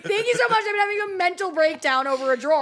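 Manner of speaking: high, loud hammed-up meltdown thanks; mock breakdown. Comedian thanks friend mid-breakdown over a drawer, mock-hysterical.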